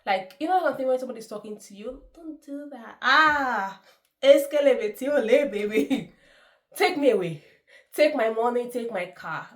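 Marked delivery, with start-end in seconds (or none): 2.1-3.0 higher, gentle feminine imitation of Bam Bam; mock soft-spoken lady. 3.0-9.5 loud, high, giddy swoon; swooning excitement. Pretend. Swooning over a charming woman.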